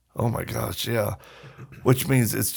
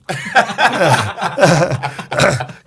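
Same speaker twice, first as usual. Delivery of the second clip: big hearty laugh, higher and louder; 7 semitones higher, 8 dB louder than usual. Real, not acted. Laughing at his cartel-home joke, loud hearty laugh. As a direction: let a loud laugh burst out at your own joke.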